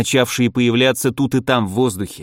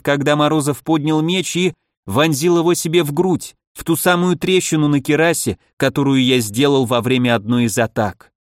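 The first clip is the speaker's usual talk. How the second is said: ordinary delivery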